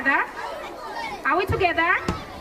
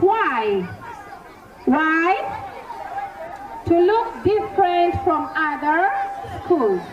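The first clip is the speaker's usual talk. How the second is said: ordinary talk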